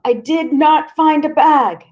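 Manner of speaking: high, loud, slow through sobs; crying boy insisting to police, high and sobbing; sobbing denial. Acted. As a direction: sob the words out. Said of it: "I started to sob".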